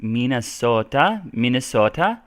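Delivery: Minnesotan lilt, long vowels and rising phrase ends; put-on Minnesotan, almost Nordic, stretching the vowels and inflecting up; Minnesota accent. Shown as a demonstration. Teacher imitating Minnesotans for viewers, Minnesota accent. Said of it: "they kind of inflect up a lot".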